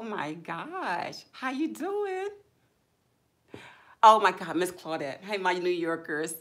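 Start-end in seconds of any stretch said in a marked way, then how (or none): 1.3-2.3 high sing-song how you doing; high greeting. Real, not acted. Streamer greeting a viewer in a high sing-song. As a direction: lift it up bright and high.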